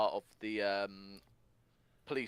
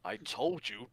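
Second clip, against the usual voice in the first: slow, higher-pitched emphatic telling-off; mock scolding; 6 semitones higher, slower than usual. Host playing Sonic scolding his victim, mock menace. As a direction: space out the words in put-on menace. Acts out the angry character's line.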